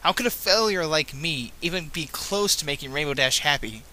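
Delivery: plain reading voice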